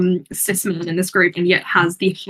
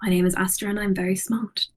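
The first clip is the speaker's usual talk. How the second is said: lightly imitated softer character voice; Astra; 3 semitones lower, 7 dB quieter than usual. Shown as a demonstration. Player copies a friend's demo line, tentative soft character voice. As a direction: copy the character's quieter tone, only slightly lowered. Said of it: "I'll try to bring it to your tone".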